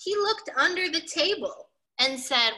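plain reading voice

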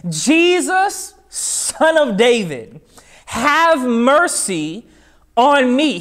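high, drawn-out calling plea; retold shout. Preacher acts Bartimaeus calling on Jesus for mercy, high retold shout. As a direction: cry out to be heard, pitched high. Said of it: "he began to shout".

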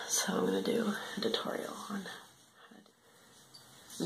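slow hushed whisper. Real, not acted. Quiet whisper on the toilet.

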